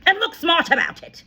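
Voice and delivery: furious headmistress shout. A narrator's reading. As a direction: bark it as a sharp command. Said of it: "the Trunchbull shouted".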